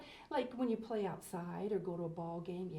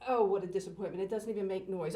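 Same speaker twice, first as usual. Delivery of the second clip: ordinary talk, nothing marked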